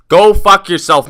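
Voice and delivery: loud, slow, drawn-out retold insult; defiant parting shot. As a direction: toss it back over your shoulder, loudly. Retold parting shot while walking out.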